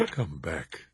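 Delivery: soft, gentle call; tender. A narrator's reading. Husband softly calling weeping wife back to bed, tender. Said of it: "he said tenderly".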